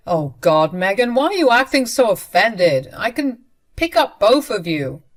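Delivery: loud, exasperated brush-off; annoyed husband. Retold or imitated. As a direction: act like she's overreacting.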